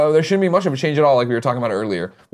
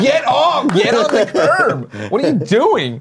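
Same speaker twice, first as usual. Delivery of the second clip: higher, louder mock-mom yell; 11 semitones higher, 5 dB louder, much slower than usual. Son imitating his mother yelling at fans in traffic. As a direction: shout like a mom scolding kids off the street. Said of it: "my mom's like".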